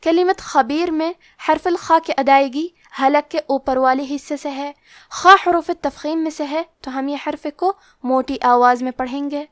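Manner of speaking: usual speaking voice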